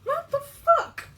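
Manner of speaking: high, slow exasperated outburst; exasperated shout. Real, not acted. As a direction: shout it out in disbelief. Struggling with stuck jar.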